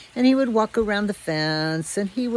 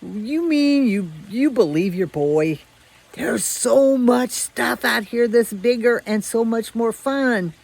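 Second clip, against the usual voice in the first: mocking disbelief; 5 semitones higher, 4 dB louder than usual. Strays mocking obedient dog.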